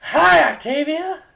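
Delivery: high, loud excited greeting; enthusiastic shout. A narrator's reading. Mare shouting hello to her friend, loud and excited. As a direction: yell it across the room with joy. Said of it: "the mare next to Frederick shouted".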